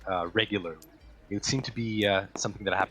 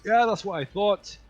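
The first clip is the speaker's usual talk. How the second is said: high, loud smug sneer; old doorman gloating as Larry flees, high and loud; 11 semitones higher, 6 dB louder than usual. Acted. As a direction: gloat as the intruder runs. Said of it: "and he goes".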